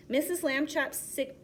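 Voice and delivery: plain reading voice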